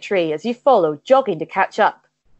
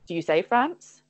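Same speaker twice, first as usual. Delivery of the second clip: usual speaking voice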